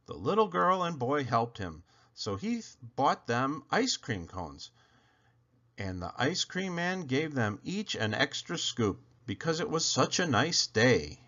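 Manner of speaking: usual speaking voice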